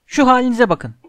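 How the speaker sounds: loud, high exasperated shout; Hermione shouting. A narrator's reading. Hermione to the trapped boys, exasperated shout. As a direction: cry out at their foolishness. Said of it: "diye bağırdı Hermione".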